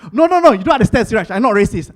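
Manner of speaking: loud protesting friend; mock defensive. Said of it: "My friend, very smart".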